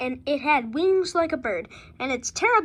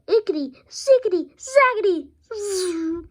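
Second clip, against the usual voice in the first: muttered spell; 4 semitones higher, 3 dB louder than usual. Acted. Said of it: "she muttered a spell".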